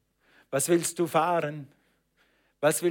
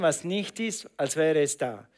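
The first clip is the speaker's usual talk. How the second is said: normal talking voice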